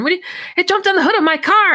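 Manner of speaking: lightly acted phone panic; imitated panic. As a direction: rattle it off as if scared. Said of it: "she is just crying".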